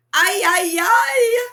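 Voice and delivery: high, loud wailing sobs; a mother's relieved weeping; sobbing. A narrator's reading. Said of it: "Mama sobbed".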